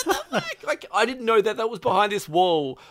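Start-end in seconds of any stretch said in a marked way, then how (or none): 0.1-0.6 high laughing yelp of what the fuck; shocked laughing outburst. Retold or imitated. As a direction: burst out in amazed laughter. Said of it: "I was like".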